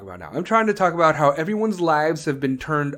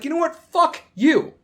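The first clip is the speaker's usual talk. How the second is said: defiant Americans; 11 semitones higher than usual. Retold or imitated. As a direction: fling it back defiantly. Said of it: "Americans are just like".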